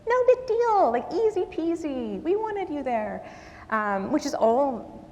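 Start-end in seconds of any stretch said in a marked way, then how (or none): 0.1-0.9 high, light, cheerful reassurance; breezy reassurance. Retold or imitated. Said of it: "the same thing that most people say". 0.9-2.2 breezy quote. Retold or imitated. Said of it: "the same thing that most people say". 2.2-3.2 high, light, cheerful reassurance; breezy reassurance. Retold or imitated. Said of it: "the same thing that most people say".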